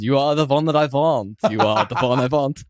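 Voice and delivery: put-on sung with a light Transylvanian accent, v for w. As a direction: croon it like Dracula in Transylvanian accent. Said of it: "I sang it in a Transylvanian accent".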